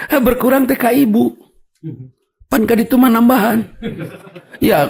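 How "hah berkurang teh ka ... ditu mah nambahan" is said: higher teasing reply. Retold or imitated. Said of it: "Sampai saya tanya".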